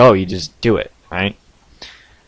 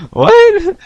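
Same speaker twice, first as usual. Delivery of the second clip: very high, loud yelp of disbelief; hammed-up startled answer, pitch leaping; startled mock shout; 11 dB louder than usual. Imitating himself answering the voice in his head, high startled yell. Startled reply to repeated phantom call.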